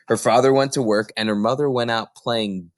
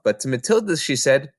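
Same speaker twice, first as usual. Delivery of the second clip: plain reading voice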